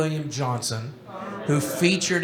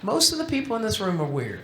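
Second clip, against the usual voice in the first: ordinary talk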